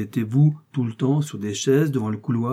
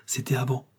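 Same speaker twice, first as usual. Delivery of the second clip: usual speaking voice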